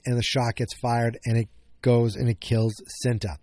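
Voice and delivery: normal talking voice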